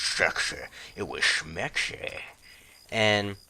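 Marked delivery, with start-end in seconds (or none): 0.9-2.2 slow teasing "schmexy" drawn out; playful schmexy. Shown as a demonstration. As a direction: savour "schmexy" slowly and smugly. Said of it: "It was schmexy".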